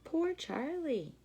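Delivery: usual speaking voice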